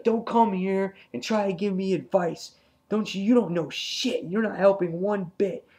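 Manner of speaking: high, heated imitation of a rant; going off. Alan rejecting Andrea's advice, going off. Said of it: "he was going off".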